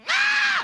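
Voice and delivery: high, loud gasping cry; mock gasp. Kid seeing someone different, high loud gasp. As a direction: burst out with a held-in "ah". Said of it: "pointing and gasping".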